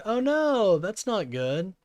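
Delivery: sudden high "oh no"; surprised. Real, not acted. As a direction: caught off guard, jumps up high. Mom's phone just broke.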